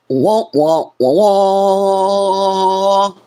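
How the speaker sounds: loud, high mock wah-wah; sad trombone. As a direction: mimic a failing trombone. Mocking a flop.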